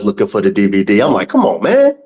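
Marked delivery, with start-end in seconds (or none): 1.3-2.0 exasperated whine. Retold or imitated. Retold frustrated reaction, laughing.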